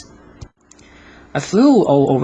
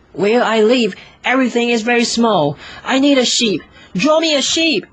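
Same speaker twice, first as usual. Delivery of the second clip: put-on little boy refusing; 8 semitones higher than usual. Little prince rejecting the drawing, high insistent child. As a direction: refuse firmly like a small child who knows what he wants. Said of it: "Then I was shocked to hear him say".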